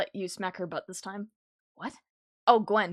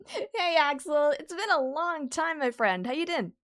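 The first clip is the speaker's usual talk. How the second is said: bright upbeat hello, higher; excited greeting; 7 semitones higher, 3 dB louder than usual. Real, not acted. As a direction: greet a friend warmly.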